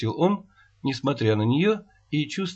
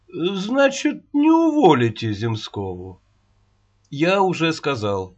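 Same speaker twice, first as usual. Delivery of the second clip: ordinary delivery, nothing marked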